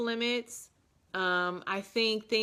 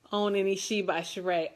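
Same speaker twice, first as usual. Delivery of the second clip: ordinary delivery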